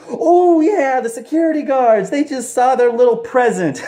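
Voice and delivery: very high, loud mock glee; mock gloating husband. Husband boasting to his wife, loud squealing glee. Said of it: "he's all like".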